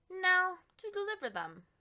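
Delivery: brisk resolve. Acted. Baker turning to the deliveries, loud and brisk. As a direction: announce the next job with vigour.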